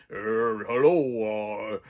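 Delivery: low, strange disguised voice; disguised killer voice. Retold or imitated. Reviewer imitates the masked killer greeting his victim in a low disguised voice. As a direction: speak low like a masked man hiding his voice. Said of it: "he has his voice".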